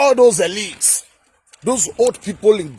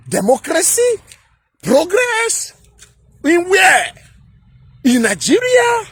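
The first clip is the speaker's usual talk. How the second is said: incredulous mockery; 4 semitones higher than usual. Real, not acted. Vlogger scoffing at Tinubu's claim, high incredulous mockery. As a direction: voice climbing high in mocking disbelief.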